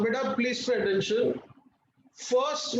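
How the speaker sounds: ordinary talk, nothing marked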